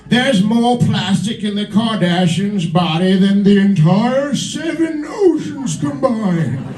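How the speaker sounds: slow, emphatic British nature narrator; David Attenborough. Acted. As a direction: plead gravely like a wildlife documentary host. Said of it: "if David Attenborough was narrating".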